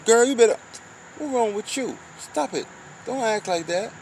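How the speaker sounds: playful high-pitched scold; mock scolding. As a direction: chide her like an auntie. Scolding a girl.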